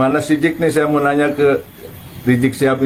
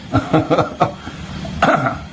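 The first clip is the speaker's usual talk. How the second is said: amused, scoffing chuckle; real burst of derisive laughter; mocking laugh; 4 semitones higher than usual. Real, not acted. Commentator scoffing at the mubahalah story, mocking laugh.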